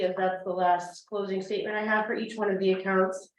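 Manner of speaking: ordinary talk, nothing marked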